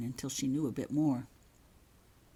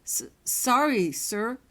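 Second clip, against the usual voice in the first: stammered apology; 6 semitones higher, 6 dB louder, slower than usual. Acted. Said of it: "Holly mumbled".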